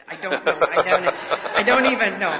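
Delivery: helpless laughter through the words; laughing. Real, not acted. Laughing about fear of decapitation.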